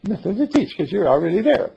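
snide, superior retort; snotty. Shown as a demonstration. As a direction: say it like a know-it-all brushing them off. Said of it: "that's kinda snotty".

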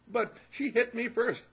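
put-on high, loud, slow kid's whine of protest; protesting child. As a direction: object like a wronged little boy. Boy protesting to parent.

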